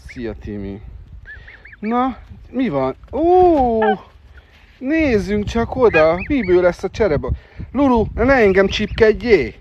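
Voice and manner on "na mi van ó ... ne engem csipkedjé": excited pet talk. Real, not acted. To the birds at the park, high delighted cooing. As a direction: coo up high and delighted at the little ones.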